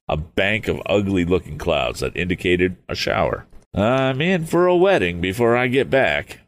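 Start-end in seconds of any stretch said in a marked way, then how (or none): 3.7-6.4 higher-pitched youthful character musing; acted musing, pitched well up; musing aloud. A narrator's reading. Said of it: "he mused".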